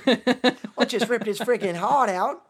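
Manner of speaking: put-on slow, drawn-out Aussie accent; Australian accent. Friend joining the Aussie bushman game, slow mock Australian. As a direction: stretch it out in broad Australian. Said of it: "our Australian accents for us".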